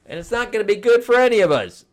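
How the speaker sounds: rally the giant for help; earnest heroes pleading. Retold or imitated. Heroes asking Ymir for help, high urgent voice. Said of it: "they're like".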